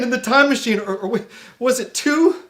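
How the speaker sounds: ordinary talk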